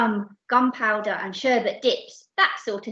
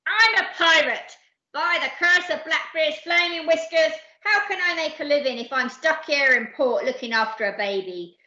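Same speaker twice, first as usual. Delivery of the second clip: loud, raised outrage settling into grumble; howling pirate captain; 4 semitones higher, 4 dB louder than usual. Acted. Captain stuck with a baby, howling pirate rant. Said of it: "howled Captain Abdul".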